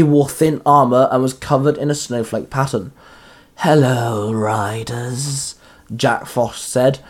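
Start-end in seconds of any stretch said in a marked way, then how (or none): 3.6-5.6 slow, drawn-out monster hello, sinking softer; menacing villain greeting. Acted. Jack Frost greets the riders facing him, menacing. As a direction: stretch each word with cold menace.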